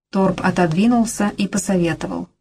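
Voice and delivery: ordinary delivery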